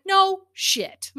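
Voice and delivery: high, loud mock outrage, "No shit."; sarcastic retort.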